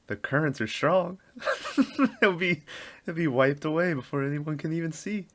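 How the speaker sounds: high, hammed-up movie line; mock dramatic line. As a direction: ham up a cheesy line.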